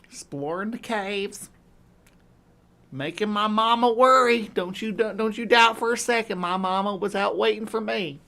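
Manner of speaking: playful backwoods Southern gal; hammed-up rural Southern accent. Shown as a demonstration. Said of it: "I sound like a very backwoods kind of gal".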